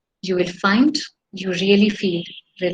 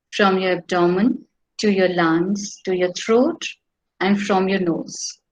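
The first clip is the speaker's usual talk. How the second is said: guided meditation. Acted. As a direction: lead the breathing calmly.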